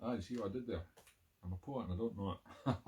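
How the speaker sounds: normal talking voice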